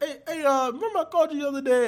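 high, fast, laughing take on dad; imitating dad. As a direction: play the dad, chirpy and rushed. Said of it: "My dad was like".

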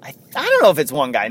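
ordinary talk

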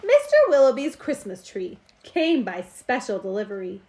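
ordinary delivery, nothing marked

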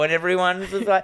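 normal talking voice

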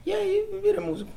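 high laughing punchline. Real, not acted. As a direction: laugh the line out. Laughs at own remark.

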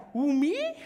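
high, loud cocky challenge; gangster. Shown as a demonstration. Preacher coaching congregation to tell a neighbour, mock gangster. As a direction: play a mock gangster taking offence. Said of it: "you gotta be gangsters".

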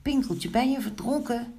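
high, worried question; anxious calling. A narrator's reading. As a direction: ask high, fearing for a friend. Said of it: "riepen de muisjes angstig". (angstig en hoog vragend)

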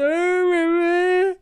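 put-on a child wailing to his mother; whiny crying kid. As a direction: whimper it like a sulky little kid.

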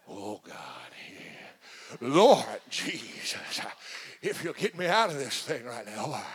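slow, low, drawn-out preacher prayer; mock-spiritual pastor voice; praying like the pastor. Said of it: "start praying like your pastor".

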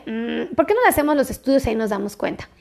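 ordinary delivery

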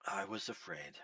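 breathy whispered confession. Shown as a demonstration. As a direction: whisper it as a secret fear. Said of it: "Underline the part of the verse that says".

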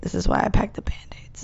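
soft unvoiced whisper. Real, not acted. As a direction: whisper it as an aside. Aside about packed Band-Aids.

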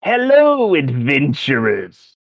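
put-on high, showy old wizard greeting; a wizard hailing heroes, high and theatrical. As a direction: boom out a welcome like a grand old wizard. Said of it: "shakes his staff and goes".

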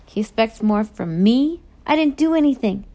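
aggrieved outburst rising in pitch; indignant protest. A narrator's reading. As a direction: protest the unfair blame. Moose protests being blamed.

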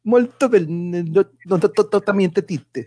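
hammed-up Italian accent; playful Italian accent, laughing; mock Italian accent. Jokey Italian bits among friends.

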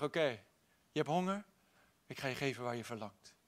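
God answering Moses softly and tenderly; gentle God voice. Acted. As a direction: hush down and answer like a kind father.

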